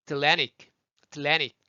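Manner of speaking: Atlantic as "at-LAN-ic"; American "Atlannic"; T turned into N. Shown as a demonstration. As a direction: drop the middle T of Atlantic into the N. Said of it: "T가 N으로 바뀌었네요".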